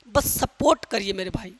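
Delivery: normal talking voice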